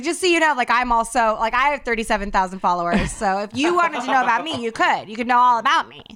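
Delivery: hammed-up pushy self-promotion; mock self-promotion. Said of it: "if I was like".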